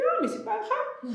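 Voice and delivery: high-pitched imitation of brushing it off; mock dismissive. Wife imitating her husband's reply to her worry, breezily dismissive. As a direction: wave the worry away, pitched well up.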